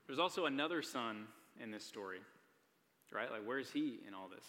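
normal talking voice

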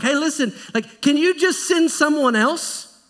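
pleading Moses. Acted. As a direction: beg to be let off the hook. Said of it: "Moses is like".

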